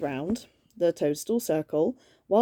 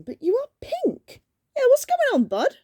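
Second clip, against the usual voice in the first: goofy high voice; 4 dB louder than usual. Acted. Jessica line turns goofy.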